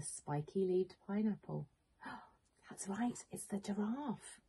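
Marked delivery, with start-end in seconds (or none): 2.0-4.4 gasp then whisper. Acted. Surprised reveal to young listeners.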